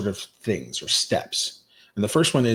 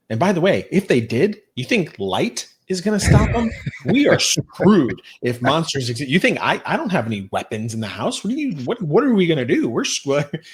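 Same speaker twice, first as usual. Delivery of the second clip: higher, louder, hammed-up know-it-all dad with a laugh; blunt logical parent; 6 semitones higher, 3 dB louder than usual. Dad lectures a scared kid about monsters, hammed-up and laughing. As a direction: play the dad who argues the kid out of monsters.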